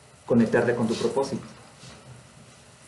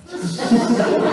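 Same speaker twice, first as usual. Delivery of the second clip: sharp high laugh; short rising laugh; 10 semitones higher, 3 dB louder than usual. Real, not acted. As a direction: burst out laughing. Laughs at the reply.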